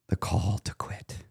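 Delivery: unvoiced whispered "The call to quit."; ominous slow whisper of "The call to quit.". Shown as a demonstration. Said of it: "that whisper right there".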